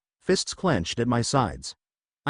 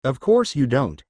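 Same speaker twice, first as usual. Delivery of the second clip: ordinary delivery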